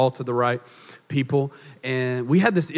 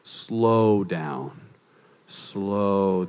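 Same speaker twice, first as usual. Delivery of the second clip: slow, soft and lower; said very slowly; 8 semitones lower, 5 dB quieter, much slower than usual. Shown as a demonstration. Congregation told to say slow down very slowly, soft and slow. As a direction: stretch each word out gently. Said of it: "say very slowly".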